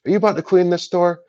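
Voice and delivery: mock high, prying voice as the rival cleaner; confronting a newcomer, mock high and prying; pushy competitor. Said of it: "and he's like".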